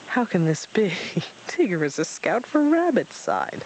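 mild stifled laughter; holding back laughter. Acted. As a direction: try not to laugh. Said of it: "hardly able to keep back the laugh".

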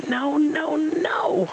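high frantic scream of no. A narrator's reading. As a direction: shriek it, stamping in protest. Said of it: "Rabbit screamed".

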